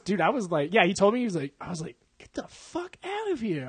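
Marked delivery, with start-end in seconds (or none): 2.2-3.7 high, slow, drawn-out incredulity; mock disbelief. Friend hearing the real age, mock disbelief. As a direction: stretch it out high, softer and amazed.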